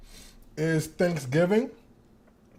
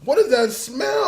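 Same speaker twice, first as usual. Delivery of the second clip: alarmed question; 8 semitones higher, 8 dB louder than usual. Retold or imitated. Retold shock at the smell.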